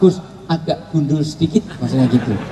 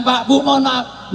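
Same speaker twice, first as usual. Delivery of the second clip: mock tearful wife; 8 semitones higher, slower than usual. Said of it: "Ummul Minin".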